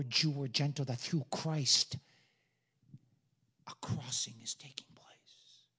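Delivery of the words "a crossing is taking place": slow hushed whisper; solemn slow whisper. Real, not acted. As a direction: whisper it as a promise. Preacher closes in hush.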